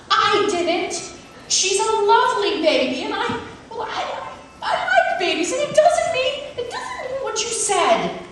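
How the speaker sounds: high, giggly protest; a hint of a flustered mother; flustered mom. Mom denying it to the guest with nervous laughs.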